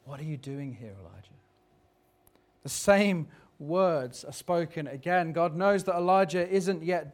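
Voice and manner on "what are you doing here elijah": very soft, lower, fading into a whisper; God gently asking, barely audible; hushed and tender, dying away on the name; still small voice. Acted. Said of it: "a voice said to him".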